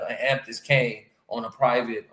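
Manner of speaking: ordinary talk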